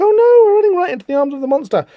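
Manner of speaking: high, fast mock panic; panicked. Fleeing pair runs into the monster, panicked.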